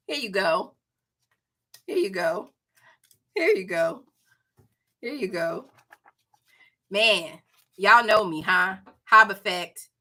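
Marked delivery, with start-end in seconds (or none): none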